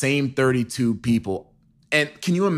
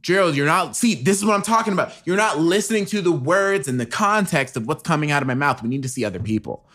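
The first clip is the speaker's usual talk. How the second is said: nagging wife; 3 semitones higher than usual. Pretend. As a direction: scold him like a fed-up wife. Wife scolding husband.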